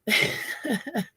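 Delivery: high laugh. Real, not acted. Laughing at herself.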